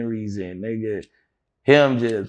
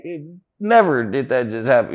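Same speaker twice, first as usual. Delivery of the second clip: normal talking voice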